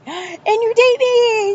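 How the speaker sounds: high, drawn-out squealed cheer; hammed-up squeal stretching the words out; mock gleeful squeal. Date teasing partner over an unseen movie, mock gleeful squeal.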